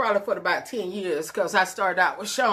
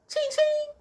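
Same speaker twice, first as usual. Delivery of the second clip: high sung ching ching; cash register sound. Retold or imitated. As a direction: mimic coins dropping in. Imitated money sound.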